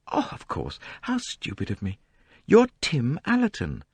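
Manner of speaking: a hint of a gracious young heiress; light, polite young woman; young woman, lightly voiced. A narrator's reading. Lynette voiced in dialogue.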